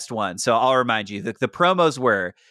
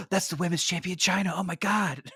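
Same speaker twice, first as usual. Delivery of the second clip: starstruck awe; 4 semitones higher, 7 dB quieter than usual. Retold or imitated.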